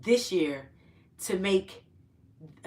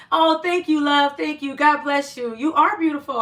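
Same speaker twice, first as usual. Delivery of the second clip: gushing thanks; 6 semitones higher, 7 dB louder than usual. Real, not acted. Thanking viewer for compliment.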